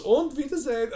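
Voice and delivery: high-pitched mock German farewell; German accent. Ride announcer bids riders farewell, German accent. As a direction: sing out a German-accented goodbye. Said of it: "With the German accent".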